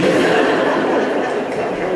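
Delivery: normal talking voice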